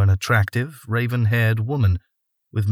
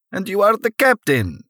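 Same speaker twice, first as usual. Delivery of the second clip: put-on high, pleased Russian accent; patting the captain on the head, delighted; heavy Russian accent; 12 semitones higher than usual. Davi again, voiced figure.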